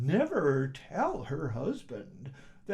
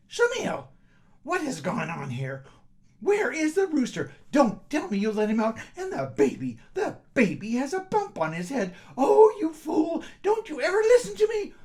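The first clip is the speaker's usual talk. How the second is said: high, loud angry scolding; furious wife; 10 semitones higher, 7 dB louder than usual. Acted. Wife finds the chaos, screams at her husband. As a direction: rage at the mess he made.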